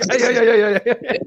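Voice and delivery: loud high laughing hey; laughing taunt. Retold or imitated. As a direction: taunt them through laughter. Shooting the roof, taunting.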